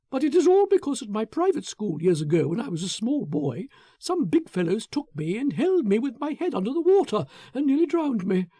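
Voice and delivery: high, quick, half-laughing confession; a man admitting his fear of water; Foster, nervous and confiding. Acted. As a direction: Foster tells Fenwick of his old fear, high and eager.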